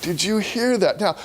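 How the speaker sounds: ordinary delivery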